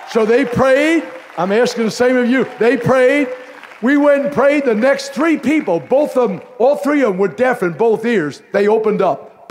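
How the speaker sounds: loud, high, driving preacher delivery; preaching. Real, not acted. Pastor driving his story home to the congregation, preaching loud. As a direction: build the sermon toward its peak.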